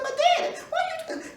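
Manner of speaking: put-on high pleading girl's voice; a daughter begging her daddy, high and small; little girl voice. Daughter pleading with angry father, high girlish voice.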